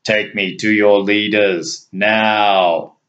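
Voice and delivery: an alien demanding obedience; forceful strange voice. A narrator's reading. Rex ordering the kneeling guard, forceful and strange. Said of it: "in a forceful, strange voice".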